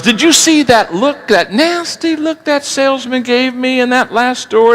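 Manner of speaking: complaining mother. Acted. Mother voiced in joke.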